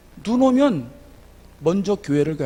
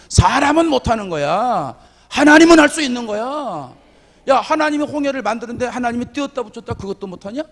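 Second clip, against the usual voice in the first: loud, rising, emphatic answer, pitched higher; bold retort; 7 semitones higher, 7 dB louder than usual. Retold or imitated. High school boy boldly answering doubting friends, loud and emphatic. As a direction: proclaim it boldly, louder and louder. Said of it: "막 이랬거든요".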